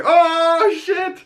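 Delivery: very high, drawn-out mock alarm; alarmed reaction. Viewer reacting to Oz's threat, alarmed. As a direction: stretch it out in a squeaky pitch.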